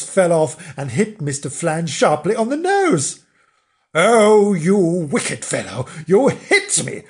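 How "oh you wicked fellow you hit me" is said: loud, affronted outburst; storybook rage, high at the edges; shouting in a rage. Acted. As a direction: explode at being wronged. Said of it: "shouted Mister Flange in a rage".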